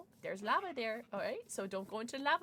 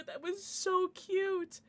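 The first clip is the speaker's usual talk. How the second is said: usual speaking voice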